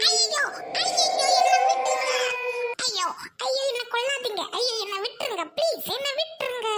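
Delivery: panicked pleading from a nightmare; crying out asleep. Acted. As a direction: beg for life in a bad dream. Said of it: "கெடுக்கிற மாதிரி கத்துற".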